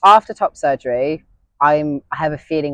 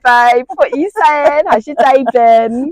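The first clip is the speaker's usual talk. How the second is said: much higher, louder camp welcome; camp greeting; 10 semitones higher, 8 dB louder, slower than usual. Retold or imitated. Greeting the girls when they come in, high loud camp voice. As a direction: coo it at the girls, camp and bright. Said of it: "the girls come in, I'm like".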